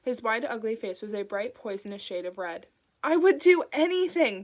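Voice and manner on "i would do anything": high, loud desperate scream; frantic screamed vow. A narrator's reading. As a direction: scream it after the car. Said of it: "Bing screamed".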